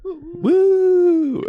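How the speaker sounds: high, loud woo; mock cheer. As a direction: whoop like a fan in the stands. Cheering for subscribers.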